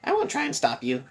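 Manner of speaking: high, teasing, gleeful mascot voice; Monokuma voice. Acted. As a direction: play the smug bear host, sing-song.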